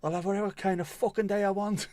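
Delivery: indignant Irishman. Retold or imitated. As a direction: snap back like an offended Irishman. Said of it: "To an Irishman, it's a threat".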